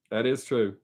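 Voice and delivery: quiet echo. Real, not acted. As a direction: repeat That is true quietly to yourself. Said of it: "That is true, Keith".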